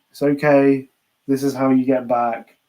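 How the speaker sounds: normal talking voice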